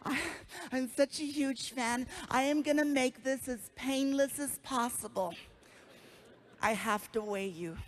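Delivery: soft, lightly sweet nurse; a gushing fan nurse, lightly voiced. Nurse gushing to a famous patient, lightly voiced. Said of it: "she's like".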